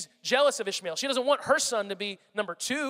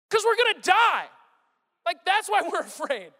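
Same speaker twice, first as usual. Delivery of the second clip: high, exasperated acted panic; panicked; 9 semitones higher, 6 dB louder than usual. Frightened disciples answering Jesus on the boat, panicked. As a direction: freak out at an absurd question. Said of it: "they're panicked, and they're freaking out".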